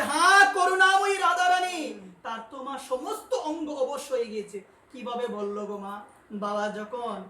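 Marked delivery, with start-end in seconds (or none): 0.0-1.9 fervent cry. Retold or imitated. Dying saint's last cry, quoted.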